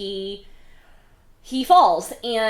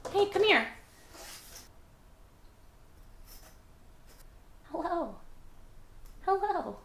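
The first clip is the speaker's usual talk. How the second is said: much higher sweet calling voice; high greeting voice; 11 semitones higher, 6 dB quieter than usual. Real, not acted. Breaks from the recap to greet someone coming in, high sweet coo. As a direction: coo a hello to whoever just came in.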